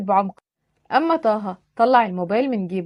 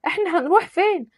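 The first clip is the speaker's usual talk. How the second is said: put-on higher girl's voice asking where to; lightly voiced young woman, worried; Misk anxious question; 7 semitones higher than usual. Misk asks while running.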